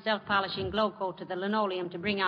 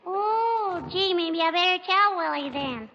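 put-on high, sweet child's voice, sing-song; little girl voice; 8 semitones higher, 3 dB louder, slower than usual. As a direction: play a cheeky little girl. [name] plays little girl Teeny.

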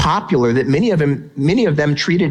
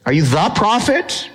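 "the prophet" rising, pitched higher; stress on THE again; 4 semitones higher than usual. Shown as a demonstration. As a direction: lift the question on "the prophet". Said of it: "And notice".

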